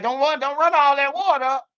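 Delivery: a high, fussy parent's scolding; mock mom yelling through the bathroom door; nagging parent. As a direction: imitate a parent fussing about the water bill. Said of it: "Motherfucker be like".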